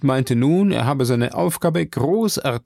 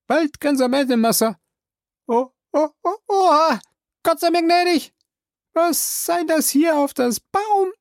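Jupiter startled. Acted. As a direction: gasp out the alarm.

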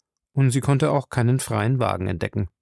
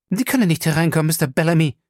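higher-pitched, firm angry refusal; mildly angry, raised pitch; cross; 6 semitones higher, 4 dB louder, much faster than usual. A narrator's reading. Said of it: "sagte sie böse".

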